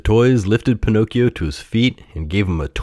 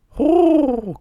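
very high, drawn-out bird hoot; calling pigeon. A narrator's reading. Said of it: "called a pigeon".

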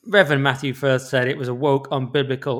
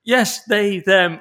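recruit shouting back; 6 semitones higher, 5 dB louder, much slower than usual. Pretend. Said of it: "the person goes".

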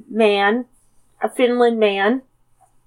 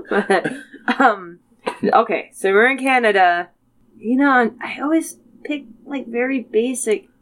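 ordinary talk, nothing marked

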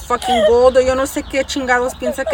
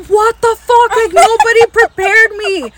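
loud, much higher outburst breaking into a laugh; shocked; 10 semitones higher, 8 dB louder than usual. Retold or imitated. Recalling childhood chicken killing to a friend, loud laughing shock. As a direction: exclaim in laughing disbelief.

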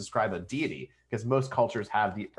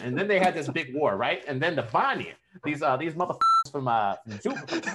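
higher-pitched, hammed-up New York-Jersey accent; 5 semitones higher, 3 dB louder than usual. Podcaster telling of the gods' big war, mock New York-Jersey accent. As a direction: spin the yarn like a riled-up New Yorker. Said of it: "New Jersey and New York".

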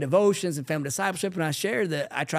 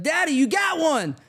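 put-on much higher, triumphant kid's call; imitated excited child; 11 semitones higher, 3 dB louder than usual. Son pointing out Dad's sin at devotions, excited. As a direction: play a son catching Dad out. Voices son catching dad out.

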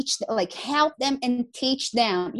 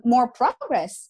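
normal talking voice

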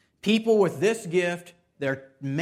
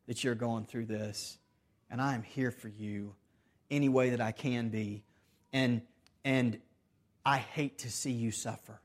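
softer, gentle and soothing; tender comfort; 4 semitones lower, 5 dB quieter than usual. Retold or imitated. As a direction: hold a suffering brother close with your voice. Merciful person comforting sufferer.

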